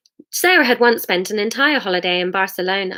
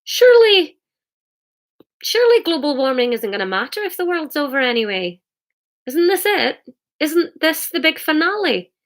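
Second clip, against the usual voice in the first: high, protesting character voice; questioning Hamish; 7 semitones higher than usual. Acted. Hamish questions Jesus about the world ending, high-voiced protest.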